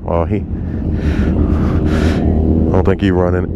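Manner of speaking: normal talking voice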